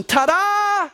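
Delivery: high, sustained triumphant shout; exultant, drawn-out high cry; Jesus shouting triumphantly. A narrator's reading. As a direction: shout the good news to everyone. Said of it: "shouting every day to every living person".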